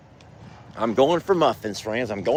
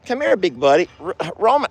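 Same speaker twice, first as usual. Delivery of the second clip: usual speaking voice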